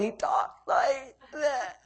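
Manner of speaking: imitating a child's slow, drooping speech; quiet and drawn out, mimicking slow motion; slow, about to cry. Pastor showing how his tearful son talks, slow and drooping. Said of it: "starts to droop real slow".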